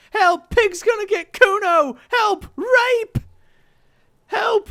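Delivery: very high, loud shrieking; shrill screaming for help. Acted. Girl screams for help across the yard, shrill and loud. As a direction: scream like a fire alarm. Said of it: "squealing shrill and violent".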